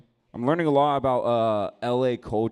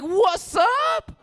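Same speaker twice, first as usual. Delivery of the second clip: much higher, louder hammed-up yell; mock shout; 8 dB louder than usual. Jumping into the fight, much higher hammed-up yell. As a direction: bellow it, joining in.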